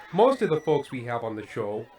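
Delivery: usual speaking voice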